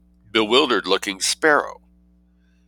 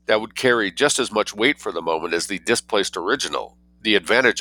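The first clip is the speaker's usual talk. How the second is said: plain reading voice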